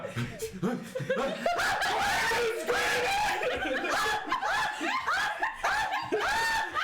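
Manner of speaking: long high shouted scream. Acted. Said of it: "And then you hear Aegon".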